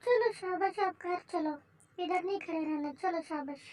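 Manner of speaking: put-on quiet, soothing nudge to a child; soft coaxing. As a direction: hush it down and coax the child home.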